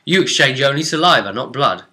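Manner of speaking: ordinary talk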